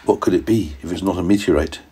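soft, hushed voice; secretive scientist. A narrator's reading. Scientist whispers to daddy and boy, hushed. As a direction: lean in and keep it secret. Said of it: "the scientist said".

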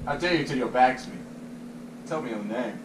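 lightly voiced quiet imperial challenge; Gladiator quote. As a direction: recite the emperor's challenge low and hushed. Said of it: "My name is Maximus Decimus".

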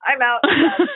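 loud, rising mock outburst; emphatic refusal. Wife telling husband she's done watching, emphatic. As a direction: quit on the spot, loudly.

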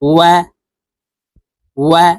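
ওয়া with lips rounded and apart, a quick rise; rounded-lip waw. Shown as a demonstration. Teacher showing students how to round lips for waw. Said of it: "এভাবে পড়তে হবে".

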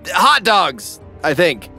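ordinary talk

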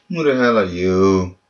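ordinary talk

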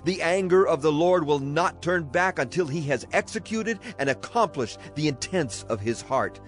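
ordinary delivery

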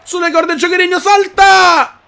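high shouted call; hype shout. Real, not acted. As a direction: yell the move up high. Hyping the jump move.

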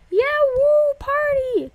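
high, loud whooping; party cheer. Real, not acted. Player celebrating a party button, excited whoop. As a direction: whoop it up at full volume.

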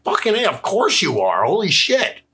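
high-pitched exclamation of realization; retold astonishment. Retelling the moment he saw the man was a cop, amazed. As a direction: exclaim it high, as the obvious dawns.

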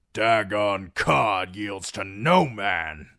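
loud, slow and heavy; slow boastful brute. Acted. Dagon Codd refusing Reek, slow and loud. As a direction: plant your feet and declare. Big cod declares.

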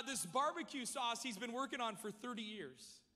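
ordinary talk

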